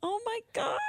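hushed, very high coo; tiny high-pitched gush. Real, not acted. Cooing over an adorable baby.

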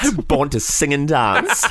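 put-on flamboyant kid.

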